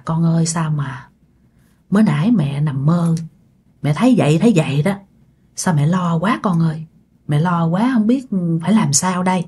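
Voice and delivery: low, subdued grandmother; worried confiding. Acted. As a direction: confide a bad dream with quiet worry. Shaken grandmother confides her worry.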